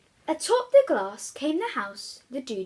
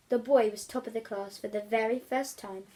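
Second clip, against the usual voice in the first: plain reading voice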